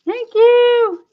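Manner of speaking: high, thrilled exclamations of relief; excited. Real, not acted. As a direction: squeal with real joy as it finally works. Relieved after finding right side.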